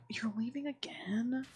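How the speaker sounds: soft, breathy, slow, guilt-tripping whisper; cat's sad whisper. Retold or imitated. Voicing the cat sadly asking why she's leaving. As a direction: whisper it like a hurt pet.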